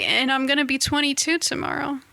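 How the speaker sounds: ordinary delivery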